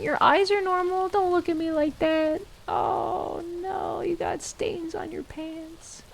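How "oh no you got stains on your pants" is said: ordinary talk, nothing marked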